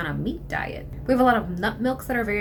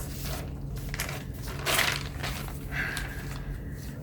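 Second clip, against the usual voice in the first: long weary exhale; heavy sigh; 8 dB quieter than usual. Real, not acted. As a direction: let out a long, tired sigh.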